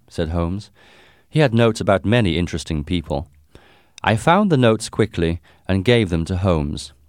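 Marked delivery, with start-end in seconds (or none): none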